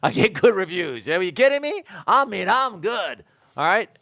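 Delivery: loud, high, cocky inner retort. Retold or imitated. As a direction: brag it out loud in your head, mock indignant. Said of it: "I'm thinking".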